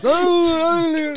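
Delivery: high, loud wrestling commentator yell; Jim Ross impression. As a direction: shout it like a ringside commentator. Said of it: "Jim Ross".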